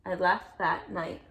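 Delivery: put-on lively read-aloud to a child; storybook reading voice; story narration. A narrator's reading. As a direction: read it like a bedtime picture book. Reading picture book aloud.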